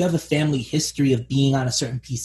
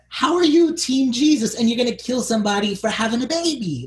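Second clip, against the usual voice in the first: high-pitched indignant challenge; incredulous outrage; 8 semitones higher than usual. Acted. Host challenging the zealous Red Paladins, incredulous and indignant. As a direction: call them out in disbelief.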